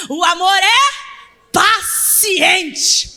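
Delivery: very slow, loud, high proclamation; solemn pulpit proclamation; preaching at full force. Acted. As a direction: proclaim it to the whole church.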